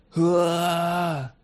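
little dog pretending to be a scary ghost, mock roar. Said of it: "brult Tobias".

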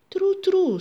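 high, loud eager confirmation; pleased, emphatic agreement; glad. A narrator's reading. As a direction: confirm happily, pitch jumping up. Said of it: "blij dat ze hem weer verstond".

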